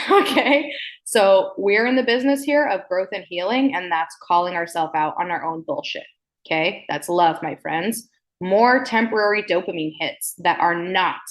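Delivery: ordinary talk, nothing marked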